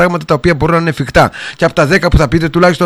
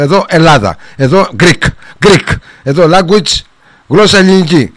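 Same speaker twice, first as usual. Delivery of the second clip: emphatic insistence; 4 semitones higher, 5 dB louder than usual. Real, not acted. Host insisting on Greek only.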